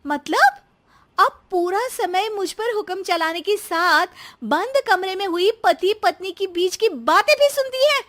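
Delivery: high-pitched, outraged disbelief; indignant daughter-in-law. Acted. Daughter-in-law challenging her eavesdropping mother-in-law, indignant.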